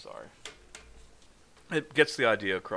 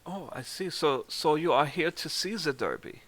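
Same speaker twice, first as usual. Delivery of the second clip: put-on higher German-accented interest; 7 semitones higher than usual. Game master as Reese learning why she came, German accent. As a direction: play an intrigued German gentleman, German accent. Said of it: "The German one?".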